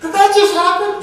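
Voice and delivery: shrieking high and loud, laughing in disbelief; incredulous disbelief. Retold or imitated. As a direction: squeal it like you can't believe what you just saw. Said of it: "in my mind I said".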